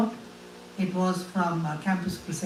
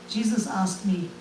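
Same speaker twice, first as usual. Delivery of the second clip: normal talking voice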